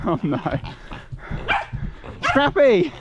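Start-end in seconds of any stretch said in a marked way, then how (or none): 2.2-2.9 high, loud call; raised voice, pitched right up; calling the dog back. Real, not acted. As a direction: holler for a runaway dog.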